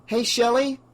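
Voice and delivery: high call over the shoulder. Acted. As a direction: call out to someone behind. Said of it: "Chris called over her shoulder".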